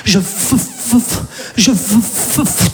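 stuck stammering on f; mock stuttering; fake stutter. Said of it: "faire semblant de bégayer".

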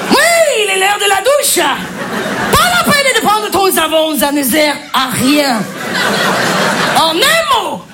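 put-on German accent. Babysitter sending children to the shower and pyjamas, German accent. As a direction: call out orders in exaggerated German-accented French. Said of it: "Humour germanique".

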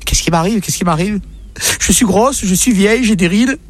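high, plaintive whining wife; whiny wife. Acted. Wife whining to her husband about her looks. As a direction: moan it up high like a wife fishing for compliments.

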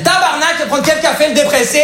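high-pitched, hammed-up Québécois accent. Waitress cutting off an oversharing customer, broad Québécois accent. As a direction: cut in with a mock Québécois accent. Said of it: "j'ai pris l'accent québécois".

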